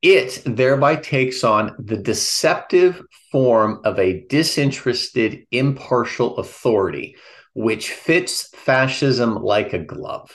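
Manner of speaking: normal talking voice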